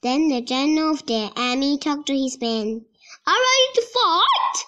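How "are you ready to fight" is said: high, fast rallying shout; general's shout. A narrator's reading. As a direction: rouse the troops before battle. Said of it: "he shouts".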